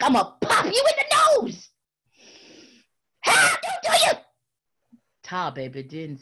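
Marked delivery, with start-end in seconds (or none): 0.0-4.1 much higher, loud threat; furious rabbit yelling a threat; threatening. Acted. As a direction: threaten to pop him in the nose. Rabbit threatening tar baby.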